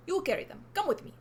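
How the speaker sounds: put-on high Russian-accented witch giving orders. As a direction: command him in a Russian accent. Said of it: "she's Russian now".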